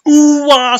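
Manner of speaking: high, loud mock impact cry; ooh-ah sound effect. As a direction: yell it like the spear landing. Imitating the spear impact.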